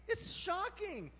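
slow, drawn-out outrage; slow shocked. Real, not acted. Preacher marveling at Jacob's deceit, slow and shocked. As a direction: let the shock stretch out slowly. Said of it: "It's insane".

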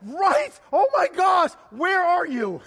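high, loud, laughing outburst; overjoyed shouting. Retold or imitated. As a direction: yell down the phone in acted-out elation. Retold joy at wallet found.

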